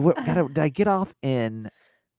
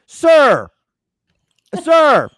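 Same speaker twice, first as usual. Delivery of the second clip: loud high call of sir; loud, high mock attendant call; flight attendant yelling; 13 semitones higher, 12 dB louder than usual. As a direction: shout sir across the cabin at him. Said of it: "they're like".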